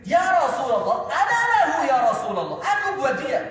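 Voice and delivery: loud, high, urgent cry; fervent volunteering. Acted. Ali pleading a third time, loud and fervent. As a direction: beg loudly to take the fight.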